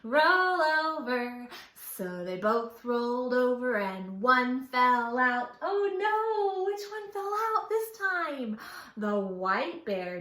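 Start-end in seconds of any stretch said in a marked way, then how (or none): 0.0-1.5 slow, louder roll over call; bear's command. A narrator's reading. Brown bear telling the others to roll over, slow and loud. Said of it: "the brown one said". 5.6-8.6 high-pitched fast oh no; mock alarm. Storyteller to children, mock surprise.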